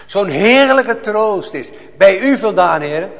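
loud, high, impassioned preaching; fiery preaching. Acted. Preacher to his congregation, a loud fervent cry. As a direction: proclaim it to the whole church with full fire.

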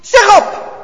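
loud, high barked demand; bellowing officer. A narrator's reading. German officer bellowing at grandpa, loud and harsh. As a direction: roar the order at grandpa. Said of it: "brult hij".

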